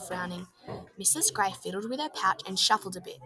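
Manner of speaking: plain reading voice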